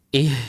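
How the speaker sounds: "Ih" spending the whole breath; empty the lungs on "Ih"; h from the chest. Shown as a demonstration. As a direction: push the h of "Ih" up from the chest. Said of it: "kita mengeluarkan dari dada".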